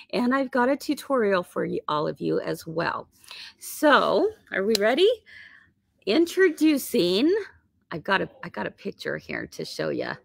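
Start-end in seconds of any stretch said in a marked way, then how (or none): none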